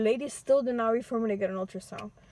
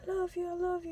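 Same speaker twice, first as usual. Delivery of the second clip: high, tender repeated love; puppy love; 8 semitones higher, 3 dB quieter, faster than usual. Retold or imitated. Fleeing over fences, telling her boyfriend tenderly she loves him. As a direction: tell him again and again, pitched up sweetly. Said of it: "kept telling each other".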